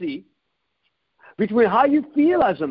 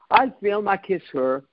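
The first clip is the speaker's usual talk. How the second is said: usual speaking voice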